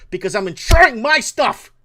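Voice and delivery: high, loud angry yell; angry shout. Real, not acted. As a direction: blow up at them for real. Rant climaxes into shouting.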